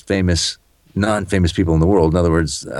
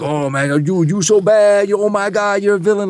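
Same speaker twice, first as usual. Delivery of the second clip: mock fan; 13 semitones higher than usual. Fans mocking him as villain.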